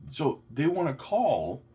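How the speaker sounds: slow, loud and mocking; sarcastic disbelief. Real, not acted. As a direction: spell out how absurd it is. Mocking the SEC's idea.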